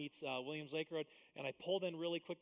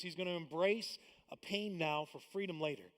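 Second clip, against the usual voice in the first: ordinary talk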